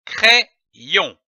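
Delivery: "crayon" split in two, pause after the first syllable; "crayon" in detached reading-lesson syllables. Shown as a demonstration. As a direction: sound out "crayon" as cré, then yon. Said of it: "L'association de ces deux syllabes donne le mot".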